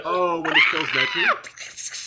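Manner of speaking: high, loud, drawn-out squeal; excited shriek. Real, not acted. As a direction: shriek it out in delight. Sudden shriek of recognition.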